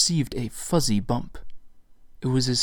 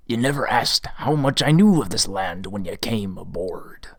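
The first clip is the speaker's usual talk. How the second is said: whispered captain voice; 2 semitones lower than usual. A narrator's reading. Old captain addressing gamblers.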